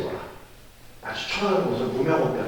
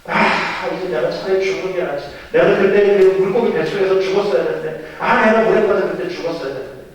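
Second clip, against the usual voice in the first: loud, higher-pitched despairing grievance; acted exasperated tirade; angry outburst; 5 semitones higher, 6 dB louder than usual. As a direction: rail at God as an aggrieved Jonah. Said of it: "이렇게 화를 내요".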